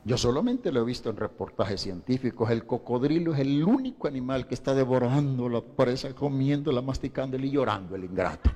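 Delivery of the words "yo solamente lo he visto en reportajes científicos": normal talking voice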